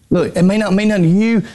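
normal talking voice

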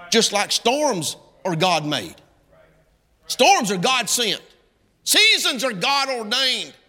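preacher climbing to a shout; building preacher's cadence. Real, not acted. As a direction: hammer each truth home slowly.

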